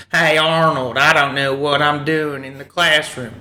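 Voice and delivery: southern cartoon character voice; Stinky. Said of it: "that southern voice is the Stinky character".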